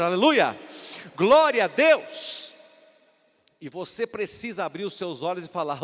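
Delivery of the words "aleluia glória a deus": shouted, much louder hallelujah; loud exultant shout; loud praise shout. Real, not acted. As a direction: cry out the praise at full force. Preacher exults.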